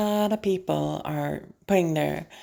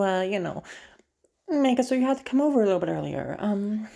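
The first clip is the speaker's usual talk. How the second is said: ordinary delivery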